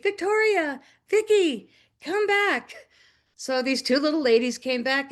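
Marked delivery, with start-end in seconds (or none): 0.0-2.7 calling out. Retold or imitated. Yelling up the street.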